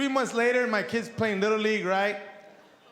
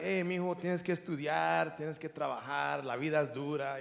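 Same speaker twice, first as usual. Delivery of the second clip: dad's Spanish advice; 6 semitones lower, 7 dB quieter than usual. Retold or imitated. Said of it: "I was like".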